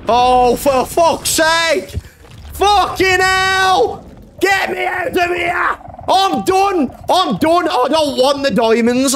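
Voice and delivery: slow, high exasperated shout; fed up, yelling at the game; stretched-out angry yell; real frustration. Real, not acted. Furious after dying again.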